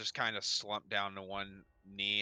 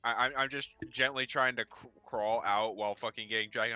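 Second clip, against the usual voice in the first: ordinary talk, nothing marked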